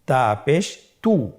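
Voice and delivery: slow "তা পেশ তু" with lips rounded on "তু"; rounded-lip demonstration. Shown as a demonstration. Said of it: "ঠোঁটটি গোল করতে হবে".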